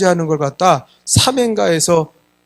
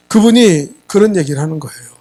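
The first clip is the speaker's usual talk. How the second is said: ordinary delivery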